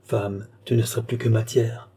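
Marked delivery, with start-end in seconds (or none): none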